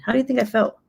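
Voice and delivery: usual speaking voice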